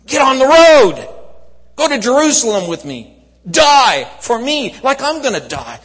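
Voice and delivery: high, full-force preaching shout; impassioned shouted call. Acted. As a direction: cry out the command to follow with urgency. Preacher voices Jesus's urgent call.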